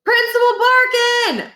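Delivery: long high loud call; high drawn-out shout. A narrator's reading. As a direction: yell to get his attention. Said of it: "Miles and Niles shouted together".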